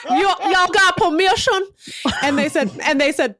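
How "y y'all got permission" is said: put-on higher, slow drawling good-old-boy; mock the pond owner's drawl. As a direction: Foghorn Leghorn impression. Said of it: "foghorn leghorn".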